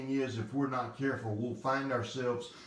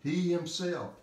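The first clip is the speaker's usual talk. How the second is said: "He himself" raised high, each word weighted; emphatic stress; 6 semitones higher than usual. Shown as a demonstration. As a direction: lean firmly on "He himself". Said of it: "It's very emphatic".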